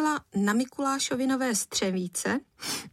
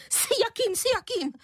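very high, scornful squawked echo; huff, then mock the question in a shrieky falsetto; indignant, far above normal pitch; unnaturally high voice; 14 semitones higher than usual. A narrator's reading. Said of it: "vykřikla paní Prchlivá nepřirozeně vysokým hlasem".

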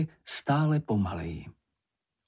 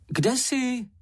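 high-pitched anxious call; crying out; 13 semitones higher than usual. A narrator's reading. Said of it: "vykřikl". (vysoký výkřik)

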